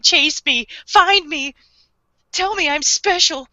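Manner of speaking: high, needy mock pleading; mock attention-seeking. Host mimicking an attention-hungry killer kid, high mock plea. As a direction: tease him as a kid begging to be chased.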